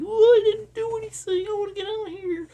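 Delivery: very high, whiny mock kid voice; whiny brat. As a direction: whine like a spoiled iPad brat. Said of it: "this whiny little".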